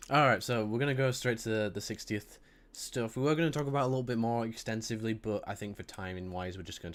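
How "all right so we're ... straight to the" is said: usual speaking voice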